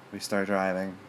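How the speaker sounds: ordinary delivery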